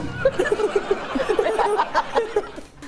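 high bursting laugh. Real, not acted. Laughing at the doctor's scolding line. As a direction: cracks up high and hard.

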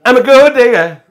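loud, high sung-out imitation. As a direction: belt it like a rapper parody. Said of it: "Read the joke like [name]".

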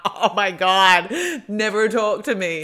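slow, loud, hammed-up disgust; mock dramatic. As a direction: overplay the outrage. Mocking a brave-talk person.

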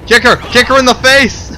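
frantic shouting at the screen; excited shout. Real, not acted. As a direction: yell it out, all fired up.

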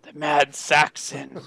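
low, slow, brooding repeat; elite huntsman. Acted. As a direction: savour the name low and slowly. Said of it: "the elite huntsman who can track".

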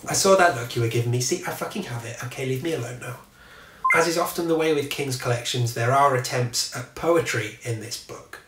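normal talking voice

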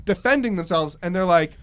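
normal talking voice

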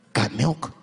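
low-pitched ad announcer; commercial voiceover. Retold or imitated. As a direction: read it as a deep tagline. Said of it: "a voice came on and said".

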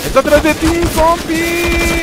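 high, loud warning yell; warning shout. Real, not acted. Warning teammate in game.

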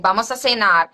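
"vamos a cenar" with an s in cenar; Latin American accent. Shown as a demonstration. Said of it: "speaking in some Latin accent like".

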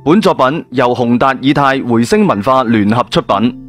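ordinary delivery

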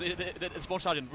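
ordinary talk, nothing marked

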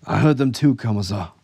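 high, loud insistence; pressing. A narrator's reading. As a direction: press the point with the officer. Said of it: "Skanto pressed".

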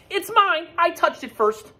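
very high and loud, insisting; indignant Goose. Acted. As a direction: stake your claim like a cross goose. Goose argues over egg.

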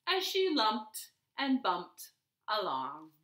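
ordinary delivery, nothing marked

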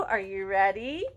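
ordinary delivery, nothing marked

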